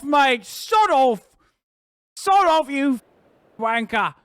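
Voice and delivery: put-on high, loud outburst in English accent; mock British yell. Streamer cursing someone off in Brit character, angry high yell.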